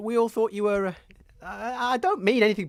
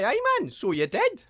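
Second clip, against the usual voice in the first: ordinary talk, nothing marked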